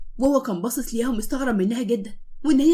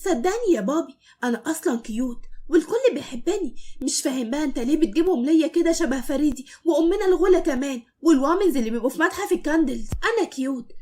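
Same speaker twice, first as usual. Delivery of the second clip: put-on higher, innocent child's voice; innocent little boy; 4 semitones higher than usual. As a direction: plead innocence to daddy. Child Zein talks. (ولد صغير بيتكلم ببراءة)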